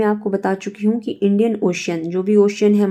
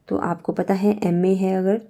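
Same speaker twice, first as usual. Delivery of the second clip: normal talking voice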